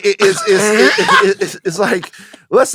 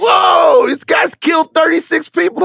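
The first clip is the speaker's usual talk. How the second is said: high loud alarmed yell; shocked shout; 12 semitones higher, 3 dB louder, slower than usual. Retold or imitated. Mock shocked reaction.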